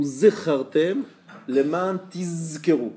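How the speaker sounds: "zechartem" and "tizkeru" slow, with a long buzzed Z; emphatic zayin. Shown as a demonstration. Teacher reading the bottom-of-page example, zayin drawn out. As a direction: lengthen each Z in "zechartem leman tizkeru". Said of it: "ils lisent mal le zayin".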